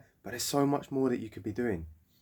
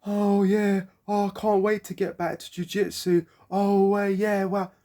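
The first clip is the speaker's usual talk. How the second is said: mocking; 7 semitones higher, 5 dB louder, slower than usual. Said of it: "they're like".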